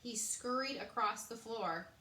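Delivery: ordinary delivery, nothing marked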